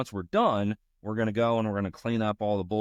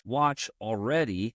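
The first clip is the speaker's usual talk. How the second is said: ordinary talk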